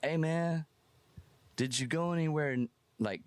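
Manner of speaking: hammed-up, high, eager questions; mock excited. Asking the fan where the shoes went, mock excited. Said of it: "I'm like".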